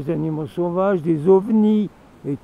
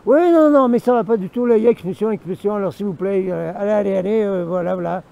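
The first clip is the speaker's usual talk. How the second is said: mayor yelling; 3 semitones higher, 4 dB louder, faster than usual. Retold or imitated. The mayor ordering the expulsion from the refuge, shouting. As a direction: shoo them out like an outraged official. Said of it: "il commence à me gueuler dessus".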